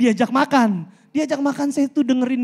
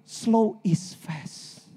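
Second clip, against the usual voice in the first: very slow, softer quote of Bo; 6 dB quieter, much slower than usual. Retold or imitated. Quoting his slow-speaking mentor Bo, very slow and soft. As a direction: draw each word out like a calm mentor. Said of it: "Emang dia kalau ngomong lambat sih".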